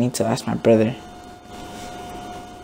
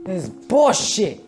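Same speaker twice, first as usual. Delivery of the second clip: high, slow exasperated complaint; drawn-out, pained protest; real frustration; 7 semitones higher, slower than usual. Real, not acted. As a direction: stretch it out in high-pitched outrage. Upset over the decision.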